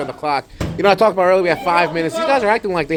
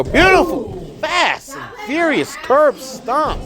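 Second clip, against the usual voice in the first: much higher, rising commentator call; excited play-by-play; 12 semitones higher, slower than usual. Acted. Commentator calling the curb stomp finish to the arena, excited and high. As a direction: sell the finishing move to the crowd.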